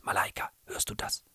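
hushed, voiceless question; toneless whisper. A narrator's reading. Brother hears something in the rain, toneless whisper. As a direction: whisper it urgently, without any tone. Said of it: "wisperte er tonlos".

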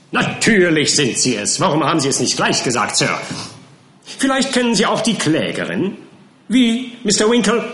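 loud, high badgering; bullying counsel. A narrator's reading. Counsel Skimpin badgers Winkle over the name, loud and high. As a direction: hammer the witness with accusation.

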